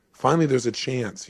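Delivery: ordinary talk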